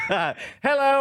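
mock pitchman hello. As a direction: announce it like a cheesy ad.

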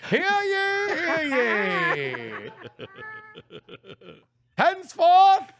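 high, loud, slow royal proclamation; town crier. Pretend. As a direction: proclaim it like a herald in the square. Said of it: "we recognize Brian Brushwood as".

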